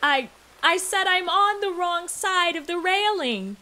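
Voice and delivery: high, breathy fake distress; hammed-up damsel calling for rescue.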